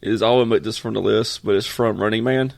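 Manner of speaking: ordinary talk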